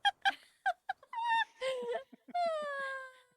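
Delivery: squealing embarrassed laugh; high shrieking laugh. Real, not acted. Friend staring, embarrassed laughter.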